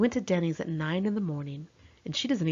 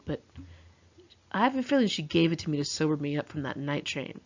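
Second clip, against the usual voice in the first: ordinary delivery, nothing marked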